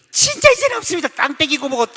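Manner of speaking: lightly voiced lady swearing she has nothing left; woman's reply. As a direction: protest as the flustered church lady. Church member answers pastor.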